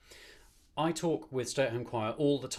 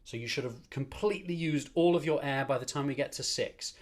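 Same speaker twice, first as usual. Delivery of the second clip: ordinary talk, nothing marked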